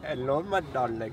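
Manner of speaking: mock Indian accent, lightly done. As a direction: put on a hammy Indian accent. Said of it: "doing an Indian accent".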